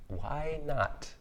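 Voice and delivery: high, drawn-out "why not"; "why not" lifted high and held; musing question. Real, not acted. Rhetorical question, then repeated softly.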